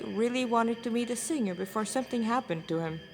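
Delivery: usual speaking voice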